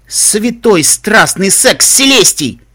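loud, slow, high exclamation; astonished oath. Acted. Reaction to allies downing the airship, astounded. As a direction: swear in drawn-out amazement.

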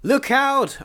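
high-pitched warning cry; alarmed scream, pitch leaping up, not full volume. A narrator's reading. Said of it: "I screamed".

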